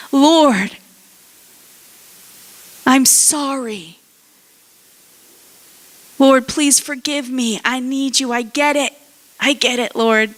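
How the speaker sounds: earnest mock confession; acted repentant plea.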